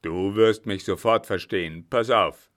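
loud, forceful villain; loud overbearing brother; domineering brother. A narrator's reading. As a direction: speak up hard, brooking no argument. Brother coldly threatens captive sister.

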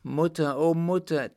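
loud, high, joyful call; jubilant cry. A narrator's reading. Girl calls her mother to see the Emperor, jubilant. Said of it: "rief jubelnd aus".

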